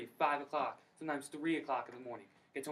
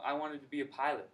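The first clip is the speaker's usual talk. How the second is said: ordinary talk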